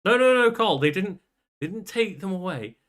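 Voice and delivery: high, loud sarcastic correction; mock-correct him, dripping irony. Real, not acted.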